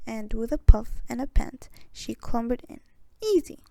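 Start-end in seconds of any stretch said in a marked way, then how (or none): 3.2-3.6 high, smug boast. A narrator's reading. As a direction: toss it off smugly. Witch boasting inside oven.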